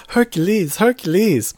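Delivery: high, loud shouted name, twice; mock excited shouting; excited scream. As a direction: yell the name like a fan. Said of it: "I'll tell you when I screamed out".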